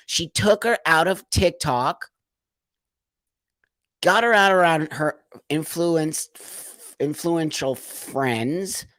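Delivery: ordinary talk, nothing marked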